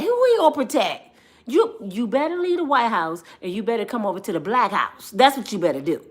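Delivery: ordinary talk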